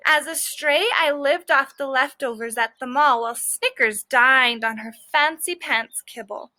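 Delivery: ordinary delivery, nothing marked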